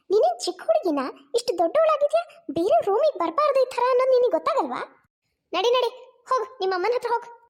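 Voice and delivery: sharp scolding, rising in pitch; scolding aunt. Acted. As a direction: shoo a child out crossly. Aunt scolds child out of room.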